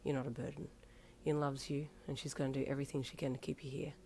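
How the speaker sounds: soft, quick comforting; tender promise. Acted. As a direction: promise him gently. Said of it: "Kuro promised".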